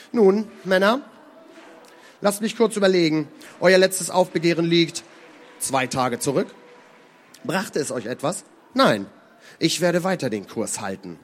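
laut, hoch ansetzender Befehlston; strenger Kapitän. Acted. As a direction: den Männern die Stirn bieten. Kapitän spricht zur Mannschaft. (entschlossener Anführer)

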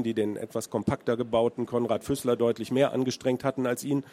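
ordinary delivery, nothing marked

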